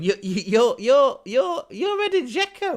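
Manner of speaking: high, loud stammering disbelief; starstruck amazement. Retold or imitated. Patient on morphine pointing at his idol, starstruck amazement.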